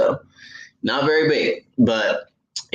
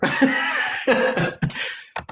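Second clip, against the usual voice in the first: a high, breathy giggle; breathy laugh; 12 semitones higher, 3 dB quieter than usual. Real, not acted. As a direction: chuckle at your own silly word. Amused after saying Heller.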